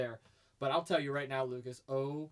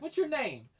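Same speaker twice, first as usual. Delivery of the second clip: imitated Southern accent, pitched up; 10 semitones higher, slower than usual. Wrestler introducing himself backstage, imitated Southern accent. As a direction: put on a Southern drawl for the question. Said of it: "he has a southern accent".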